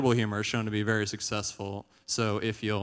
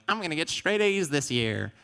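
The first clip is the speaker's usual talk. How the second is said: put-on eager kid; 6 semitones higher than usual. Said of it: "when I was a kid".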